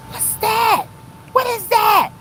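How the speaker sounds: high startled question at a creature. Real, not acted. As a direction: jump up in pitch at something in the water. Startled by animal in water.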